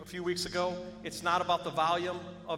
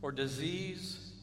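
much softer, low prayer voice; 4 semitones lower, 8 dB quieter than usual. Acted. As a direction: pray quietly over the room. Altar call prayer.

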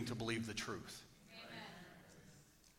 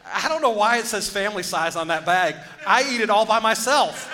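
much higher and louder, shouting the joke; loud punchline; 8 semitones higher, 12 dB louder than usual. Acted. Preacher shouting the chip bag joke to the congregation, loud and high. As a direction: belt out the punchline for laughs.

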